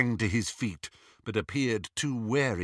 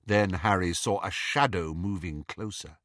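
usual speaking voice

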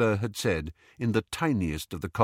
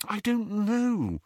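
high, slow, helpless answer; hopeless; 13 semitones higher, slower than usual. A narrator's reading. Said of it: "she said hopelessly".

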